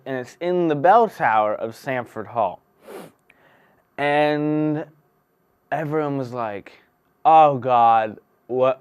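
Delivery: booming mock fairy-tale narrator; storybook narration. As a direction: tell it like a bedtime tale to a big room. Tells a fairy-tale style story.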